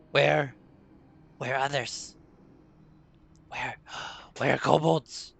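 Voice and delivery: a confused goblin checks whether it is alive; frightened goblin. Acted. Healed goblin asks the orc whether it is dead, in a nervous creature voice.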